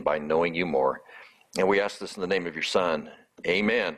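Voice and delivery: low, earnest pastor's prayer voice; opening prayer. Real, not acted. Pastor praying before the sermon, low and earnest. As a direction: pray humbly to the Father over the congregation.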